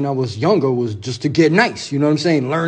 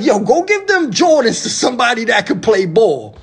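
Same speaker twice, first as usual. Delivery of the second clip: much higher, teasing mentor voice; mentor's mocking voice; 7 semitones higher, 4 dB louder than usual. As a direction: pitch way up to play the roasting mentor. Mentor roasting him, voiced high.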